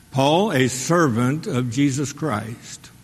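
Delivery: ordinary talk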